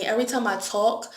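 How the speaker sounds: usual speaking voice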